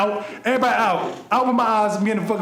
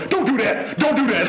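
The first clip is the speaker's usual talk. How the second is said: higher, teasing imitation of the brother; mocking brother; 7 semitones higher, 3 dB louder than usual. Brother hugging him at the funeral, mocking higher voice. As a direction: mimic him in a needling voice. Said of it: "my brother comes up and hugged me and go".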